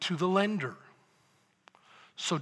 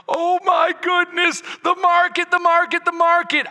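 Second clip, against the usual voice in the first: mock panic; 13 semitones higher, 11 dB louder than usual. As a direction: ham up the market panic in a shrill wail. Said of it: "when everybody's freaking out, going".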